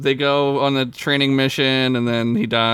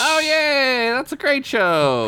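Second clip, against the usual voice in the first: excited; 13 semitones higher than usual. Real, not acted. Delighted by a favorite show.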